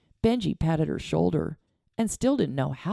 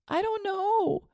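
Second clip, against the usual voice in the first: put-on higher, slow girlish wail; slower than usual. A narrator's reading. Girl crying over her dead father, wailing. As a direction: let it break into a wail. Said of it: "Jenny wailed".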